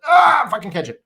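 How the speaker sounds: loud, high yell at the fielder; frustrated fan yell. Retold or imitated. Fan yelling at a fielder who won't catch the ball, loud and high. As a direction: bark it at the screen. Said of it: "I'm like".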